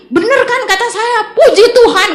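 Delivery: high, loud triumphant exclamation; overjoyed. Retold or imitated. Two friends rejoicing over the Amen reply, overjoyed. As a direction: exult as if proved right. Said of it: "Dia ikut seneng banget".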